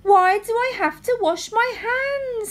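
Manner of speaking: put-on high whiny little girl; cartoon princess. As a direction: list it all in a high whine. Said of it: "said the little princess".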